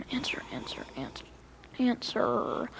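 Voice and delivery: hushed, whispered pleading; urgent whisper. Acted. Boy waiting for Mister Keith to pick up, whispering. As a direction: whisper it under your breath.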